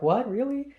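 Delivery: high, surprised "What, really?"; mock surprise. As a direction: act startled in disbelief, half joking. Retold reaction to the friend's remark.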